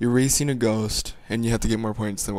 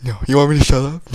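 ordinary talk, nothing marked

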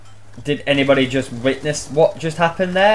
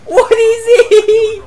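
high laughing squeal; 5 dB louder, much slower than usual. Real, not acted. Gamer laughing at opponent.

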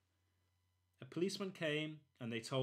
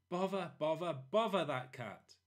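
loud, higher, exasperated shout; angry outburst; 5 semitones higher, 6 dB louder than usual. Acted. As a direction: blurt it out fed up. Parents woken, angry.

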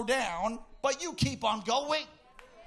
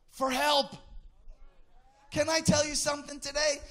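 usual speaking voice

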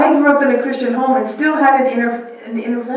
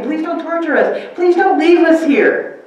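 begging demons; 7 semitones higher, 3 dB louder than usual. Retold or imitated. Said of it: "They begged him".

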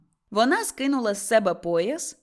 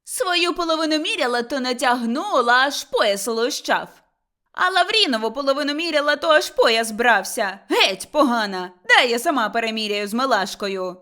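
expose the cheat and push her aside; angry accusation; 2 semitones higher, 6 dB louder than usual. Acted. Mother-in-law accuses her of cheating. (гучне сварливе викриття)

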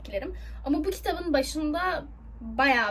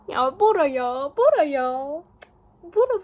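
louder, drawn-out call of Bora with a rise; excited gushing; 2 semitones higher, 7 dB louder, slower than usual. Real, not acted. Fan gushing over her favorite character Bora, excited. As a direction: swoon over the name.

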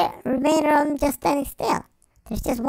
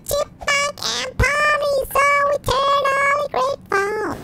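squeaky high sing-song chipmunk; high, sung thanks like a chipmunk; 12 semitones higher than usual. Acted.

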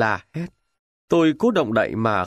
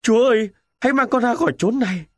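high, loud, fast horrified plea; wounded lieutenant crying out to God in terror; terrified cry; 10 semitones higher, 4 dB louder, faster than usual. Acted. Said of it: "Tôi kêu lên".

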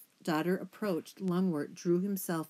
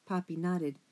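ordinary delivery

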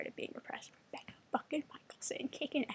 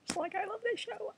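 much higher, squealing with delight; excited gush; 10 semitones higher than usual. Real, not acted. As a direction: let the joy burst up high. Gushing about the show returning.

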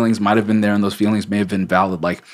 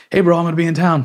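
high, casual buddy voice; breezy friend; 8 semitones higher, faster than usual. Retold or imitated. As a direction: toss it off like an easygoing friend. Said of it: "And Sam's like".

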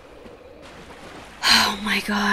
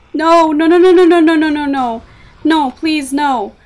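panicked pleading; 7 semitones higher, 14 dB louder than usual. Real, not acted. Character about to die.

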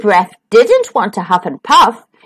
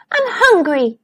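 mild moan; 5 semitones higher than usual. Acted. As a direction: complain lightly, barely moaning. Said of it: "he moaned".

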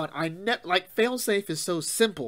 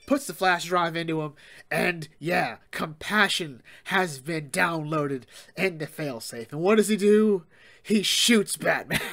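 ordinary talk

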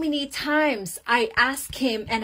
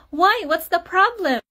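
high, louder puzzled questioning; alarmed wife; 8 semitones higher, 4 dB louder than usual. Retold or imitated. Wife questioning her crying husband, alarmed. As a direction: demand to know, pitched right up.

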